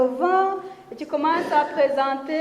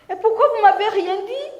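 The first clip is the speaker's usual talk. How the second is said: higher and louder, hurt protest; dismayed reproach; 7 semitones higher, 6 dB louder than usual. Retold or imitated. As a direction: cry out why nobody told her. Corinne reproche au prof. (Corinne au professeur, reproche blessé, plus aigu et plus fort)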